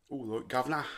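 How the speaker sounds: bad put-on Mockney accent; hammy Mockney accent on one word. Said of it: "my bad Mockney accent".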